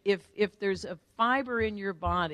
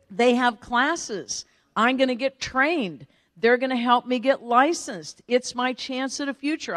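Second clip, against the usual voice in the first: loud, higher-pitched daughterly protest; earnest pleading; 5 semitones higher, 6 dB louder than usual. Retold or imitated. Daughter convincing mom about her chance, pleading. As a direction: plead your case to mom.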